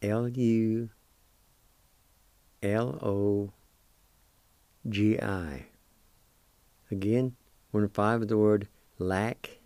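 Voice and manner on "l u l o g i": slow letter-by-letter spelling. Shown as a demonstration. Teacher spelling a word letter by letter. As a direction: dictate each letter with pauses for the learners. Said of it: "It's spelled like this".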